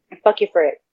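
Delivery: ordinary talk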